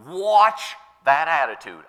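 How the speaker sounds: slow, with a loud hit on "watch"; weighty retold warning. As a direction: stretch and punch "watch", then ease off. Voicing the apostle's warning.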